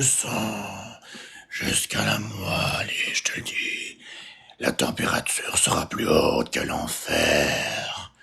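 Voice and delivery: very slow, low, soft vampire voice; movie vampire. Shown as a demonstration. Vampire threatening to suck your blood, low slow creature voice. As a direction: draw it out low like a film vampire. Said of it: "une voix de vampire dans les films".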